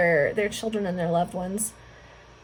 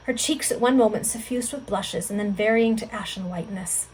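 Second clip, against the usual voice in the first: plain reading voice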